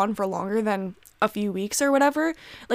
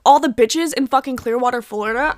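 ordinary talk, nothing marked

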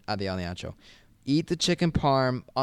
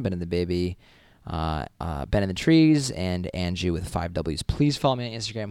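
ordinary talk

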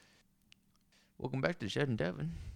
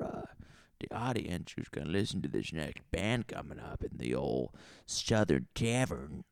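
normal talking voice

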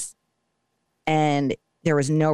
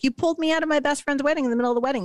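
fast, high-pitched mock protest; retold exasperation; 11 semitones higher, much faster than usual. Wife to husband about leaving the wedding, retold fast protest.

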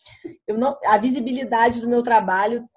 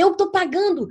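indignação; 7 semitones higher than usual. Retold or imitated. Indignada com cobrança do jogo.

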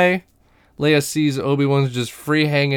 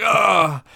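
higher imitated groan of pain; mock pained groan; 6 semitones higher than usual. Obi-Wan is kicked by the goons, mock pained groan.

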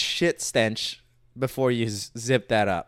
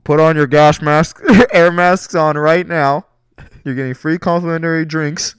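flight attendant announcement; 9 dB louder than usual. Pretend. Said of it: "intercom and be like".

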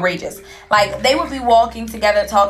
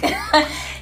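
breathless laugh; 7 semitones higher than usual. Real, not acted. Laughs at confused man.

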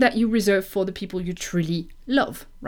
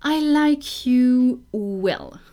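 I like you well said slowly and louder; slow, loud demo; 4 semitones higher, 5 dB louder, much slower than usual. Shown as a demonstration. Teacher slowly giving the literal meaning. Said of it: "if you want to say to someone".

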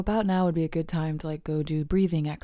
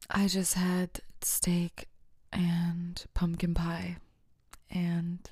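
slow savoring whisper; slower than usual. Real, not acted. Host whispering what she ate. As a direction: whisper it slowly.

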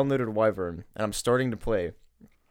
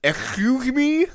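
slow, much higher and louder cartoon duck voice; Daffy Duck; 12 semitones higher, 7 dB louder, much slower than usual. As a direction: squawk it like an indignant cartoon duck. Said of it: "that was my Daffy Duck impression".

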